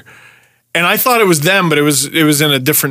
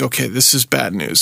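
worried mutter; 5 semitones lower, 5 dB quieter than usual. Retold or imitated. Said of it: "So I'm like".

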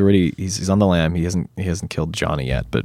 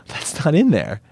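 high, startled remark; surprised realization; 8 semitones higher than usual. Retold or imitated. Actor seeing his cut scene in the weekly promo, startled. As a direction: catch yourself noticing a cut scene. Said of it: "I went".

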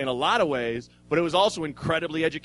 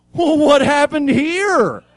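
much higher, louder, slow cooing voice; talking to a child; 11 semitones higher, 12 dB louder, slower than usual. Retold or imitated. Cop finds him stuck, cooing as to a child. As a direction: talk down to him like a stuck toddler. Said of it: "Like I'm a child".

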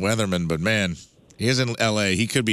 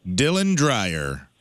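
slow announce; much slower than usual. Acted. Host reads quiz name dramatically.

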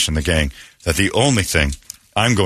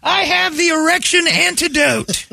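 slow, very high proclamation; mock herald; slower than usual. Proclaiming an antidote to the crowd, high mock herald. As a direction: draw it out in a hammed-up falsetto.

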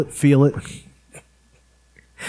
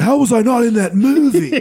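manic, indignant Cage, laughing through it; Nicolas Cage impression; 9 semitones higher than usual. Retelling Samberg's desk bit, laughing Cage voice. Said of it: "whenever he was Nicolas Cage".

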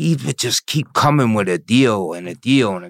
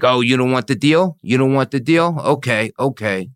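a hint of a snide prosecutor, higher; mocking prosecutor; 6 semitones higher, 3 dB louder than usual. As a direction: taunt him lightly, mock-sweet. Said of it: "She's like".

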